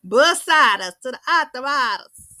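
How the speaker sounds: light southern accent impression; southern twang imitation. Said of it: "she got that southern twang".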